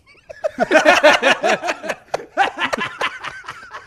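high, loud belly laugh; cracking up hard; howling with laughter; loud laughing fit. Real, not acted. Bursts out laughing.

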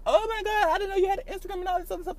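put-on high, fast girly gush; mock high-pitched girl squealing the news; mock fan voice. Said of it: "a lot of people be like".

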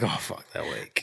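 low, very quiet groggy dread; dismayed mutter. Acted. Parent hearing the kids wake up, muttered dismay. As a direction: mutter it under your breath. Dreading children waking, acted.

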